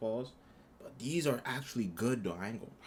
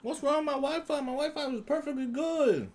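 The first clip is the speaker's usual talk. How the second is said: much higher, louder exasperated complaint; frustrated outburst; 4 dB louder than usual. Real, not acted. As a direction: vent at the broken Wi-Fi. Frustrated at his Wi-Fi.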